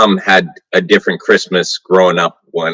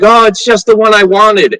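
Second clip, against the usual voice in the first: put-on excited kid; 9 semitones higher, 4 dB louder than usual. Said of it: "And I was like".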